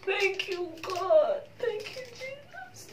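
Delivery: high, slow, crying prayer; real tearful prayer; tearful thanks. Real, not acted. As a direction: sob out gratitude.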